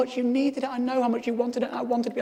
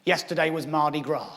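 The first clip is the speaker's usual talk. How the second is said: the stranger's reply; 8 semitones lower than usual. Retold or imitated. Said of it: "He said".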